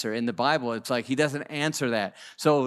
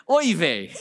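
exasperated oy vey; 2 semitones higher, 3 dB louder than usual. Retold or imitated. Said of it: "Abraham has got that chutzpah".

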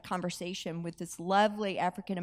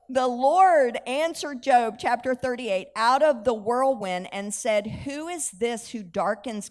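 slow, louder solemn reading of Job; scripture reading; 6 dB louder, slower than usual. Acted. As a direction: read it out slowly, weighty and grand.

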